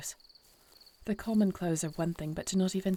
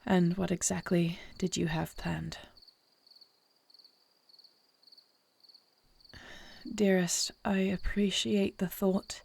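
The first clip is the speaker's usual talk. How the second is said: hushed. Acted. Queen murmuring to her husband in the dark garden.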